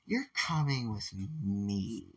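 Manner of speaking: slow, soft and deliberate. Retold or imitated. Momo pulls Izuku from his chair with a slow, firm command. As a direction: say it slowly, with no room to argue.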